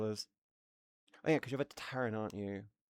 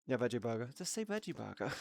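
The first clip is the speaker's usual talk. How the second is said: ordinary talk, nothing marked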